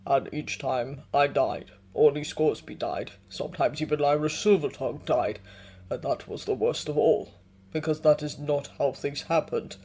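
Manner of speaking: put-on low and grave like an old bear; deep bear voice. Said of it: "Yorick's deep voice".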